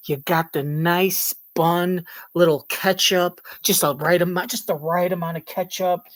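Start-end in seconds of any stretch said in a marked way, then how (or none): none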